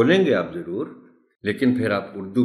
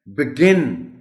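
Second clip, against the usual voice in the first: "بگن" as bi-GIN, high and loud on GIN; stress on G; 6 semitones higher, 5 dB louder, much slower than usual. Shown as a demonstration. Teacher modelling stress for pupils, "بگن" as bi-GIN. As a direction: punch the second syllable of "بگن". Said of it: "stress جی پر ہے".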